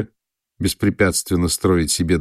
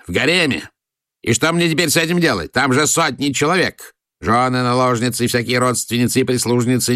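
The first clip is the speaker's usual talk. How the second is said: much higher, ranting fury; furious tirade; 5 semitones higher than usual. A narrator's reading. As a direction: rage at being cheated. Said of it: "разразился бешеной бранью". (граф в бешенстве бранится, всё выше)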